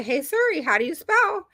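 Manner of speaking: calling Siri. Retold or imitated. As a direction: model a request to the voice assistant.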